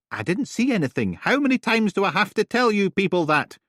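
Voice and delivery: loud, high, fed up; tired of being asked; exasperated. Acted. Neighbour snapping at police, exasperated.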